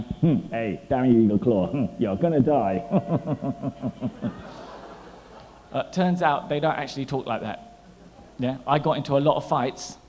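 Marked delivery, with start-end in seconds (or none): 0.0-2.8 low, loud kung fu film growl; menacing movie fighter voice, slow at the end; kung fu movie villain. Retold or imitated. Comic confronting people in Chinatown as a kung fu villain. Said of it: "walking up to Chinese people ... going". 2.9-5.1 evil movie laugh. Retold or imitated. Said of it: "walking up to Chinese people ... going".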